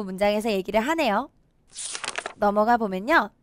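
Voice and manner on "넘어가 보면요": normal talking voice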